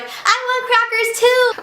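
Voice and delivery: put-on high, drawn-out little-boy wail; imitated child's scream. Woken little brother wanting his share, high mock scream. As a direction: mimic a small kid crying out, slow and high. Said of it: "starts screaming".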